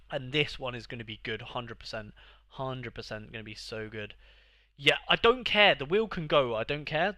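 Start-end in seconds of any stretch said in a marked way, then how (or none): 4.8-7.2 loud, defensive "I don't care"; annoyed. Real, not acted. Player yelling he doesn't care about the wheel. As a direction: bark it like you really do care. Angry denial after loss.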